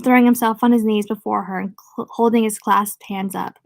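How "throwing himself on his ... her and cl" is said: plain reading voice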